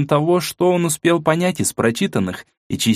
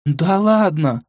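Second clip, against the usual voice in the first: surprised exclamation; 6 semitones higher, much slower than usual. A narrator's reading. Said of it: "воскликнула Мила".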